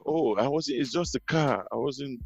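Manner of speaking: mock timid excuse. Newcomer to the cop, meek quiet mock excuse. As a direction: shrink back and apologise quietly, mock-nervous. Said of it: "you'd have been more like".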